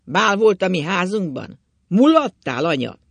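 loud, high-pitched jeering; taunting questions. A narrator's reading. Husband accuses his wife of revelling at home, loud taunt. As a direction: throw it at her, loud and climbing.

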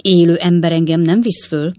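loud, ringing proclamation; booming declaration. Acted. Tipsy Pickwick declares no one will carry him up, booming. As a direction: announce it boldly to the room. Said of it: "jelentette ki Pickwick úr harsányan".

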